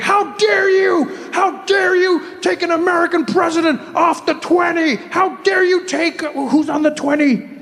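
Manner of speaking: high, slow outraged shouting; hammed-up indignation at the bill change. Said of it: "They're like".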